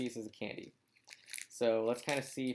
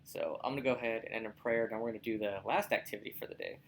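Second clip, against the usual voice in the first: normal talking voice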